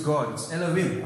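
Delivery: ordinary talk, nothing marked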